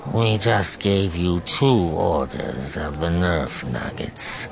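drawn-out creature voice, sinking low. A narrator's reading. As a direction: confide it slowly as an amused creature. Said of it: "she said in a low voice".

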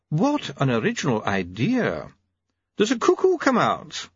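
mock admiration, then a jab; dry sarcasm. Doctor Hopkins mocking.

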